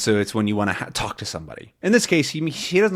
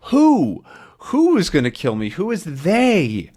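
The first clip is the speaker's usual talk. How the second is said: urgent question; 5 semitones higher than usual. Acted. Tintin urgently asking.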